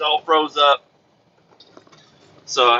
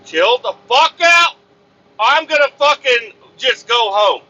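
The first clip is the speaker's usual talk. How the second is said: raised, high-pitched exasperated rant; fed-up outburst; 6 semitones higher than usual. Retold or imitated. Driver to everybody yelling at him, fed-up outburst. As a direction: blow up at everyone hounding you, then threaten to leave.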